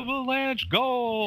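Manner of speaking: high, drawn-out goal call; in-arena announcer. Acted. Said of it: "the, uh, in-arena announcements".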